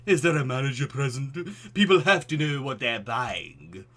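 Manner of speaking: put-on pompous old-world grandeur, booming; Vincent Price voice. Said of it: "my Vincent voice".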